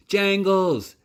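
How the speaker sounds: high, loud glad call. Acted. Nellie calls to the rabbit by her old name, joyful. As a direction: call out with joy.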